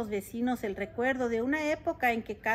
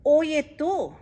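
loud, higher grumpy complaint; grumpy mayor complaining loudly; 4 semitones higher, 5 dB louder, much slower than usual. Acted. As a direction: snap it out like a cranky mayor. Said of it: "se quejó don Pepe".

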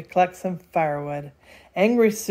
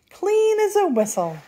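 contented sigh; 12 semitones higher than usual. A narrator's reading. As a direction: sing out a sighed remark, very high. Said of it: "she sighed".

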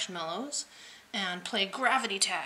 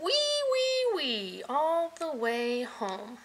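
slow squealing wee; 5 semitones higher, slower than usual. A narrator's reading. Piggy squeals all the way home.

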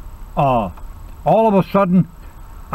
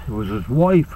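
normal talking voice

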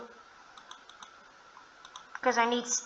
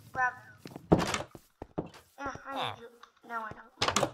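usual speaking voice

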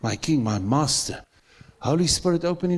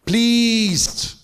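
congregation response shout; 9 semitones higher, 5 dB louder than usual. Real, not acted. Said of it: "God is what by faith?".

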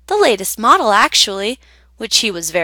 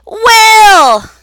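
much higher, loud call; crying out; 13 semitones higher, 10 dB louder than usual. Acted. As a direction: yell it up at the broomstick. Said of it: "would simply cry".

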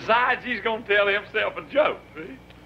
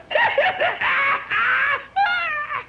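cracking up. Real, not acted. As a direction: lose it mid-punchline. Laughs midway through punchline.

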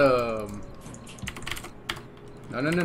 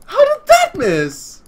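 high, slow disbelief; incredulous; 8 dB louder, slower than usual. Real, not acted. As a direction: stretch it out in disbelief. Repeat of the same phrase.

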